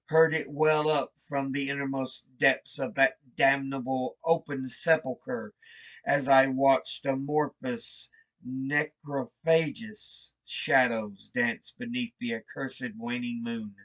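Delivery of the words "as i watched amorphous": ordinary delivery, nothing marked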